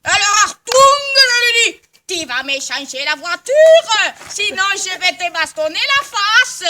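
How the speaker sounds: shouted fake German accent, slow and high; mock German accent. Woman mimicking a German to the car dealer, mock German accent. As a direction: bark it out in a hammed-up German accent. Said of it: "Les Allemandes, ils parlaient comme ça".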